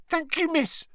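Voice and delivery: high, earnest elf squeak; squeaky elf voice. A narrator's reading. Dobby voiced.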